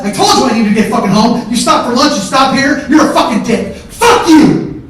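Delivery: loud, high mocking tirade; screamed rant building to a final blast; enraged yelling. Furious brother hurling insults at comedian, enraged yelling. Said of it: "Stop yelling".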